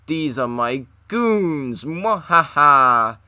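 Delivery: slow drawn-out villain gloat ending in a laugh; slow theatrical villain menace. Acted. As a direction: gloat like a cartoon villain, slowly. Joker voice with evil laugh.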